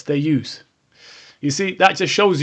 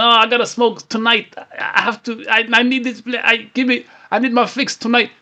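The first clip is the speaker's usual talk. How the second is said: higher-pitched craving, tripping over restarts; struggling smoker; 5 semitones higher than usual. Acted. Vlogger mimics a smoker needing tonight's cigarette, desperate pleading. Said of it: "If you're really struggling".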